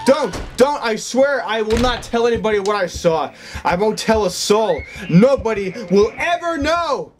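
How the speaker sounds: high, pleading, close to crying; real terrified begging to the ghost; panicked pleading. Real, not acted. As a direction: beg for your life, voice up high.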